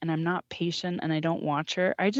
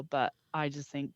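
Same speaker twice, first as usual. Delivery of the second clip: ordinary talk, nothing marked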